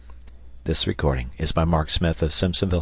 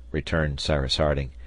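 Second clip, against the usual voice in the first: usual speaking voice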